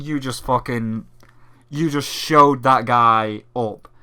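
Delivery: normal talking voice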